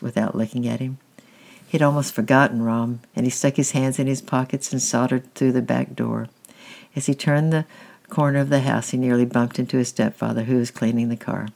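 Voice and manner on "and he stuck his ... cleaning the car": usual speaking voice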